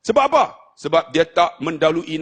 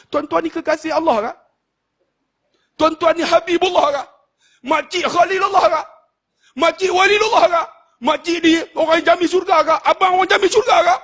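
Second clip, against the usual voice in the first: much higher and louder questioning; fiery rhetorical preaching; 12 semitones higher, 3 dB louder than usual. Acted. Preacher challenging the crowd whether they are beloved of God, rousing. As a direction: challenge them, then let it sting.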